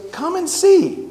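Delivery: put-on very high, eager toddler voice; little boy's voice. Toddler inviting his dad over, very high and eager. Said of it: "And he goes".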